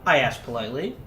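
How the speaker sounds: normal talking voice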